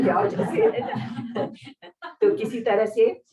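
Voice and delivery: breaks into a high laugh. Real, not acted. Recalling her father's angry words, laughing. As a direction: laugh off the scolding.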